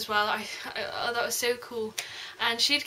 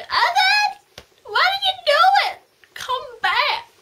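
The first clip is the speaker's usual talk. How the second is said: very high, loud anguished cry; mock despairing wail; 8 dB louder, slower than usual. Reader crying out as Evan leaves alone, hammed-up wail. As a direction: wail after him like a heartbroken heroine. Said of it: "and was like".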